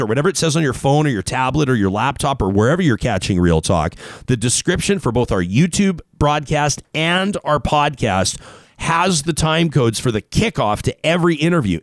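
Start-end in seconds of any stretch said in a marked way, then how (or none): none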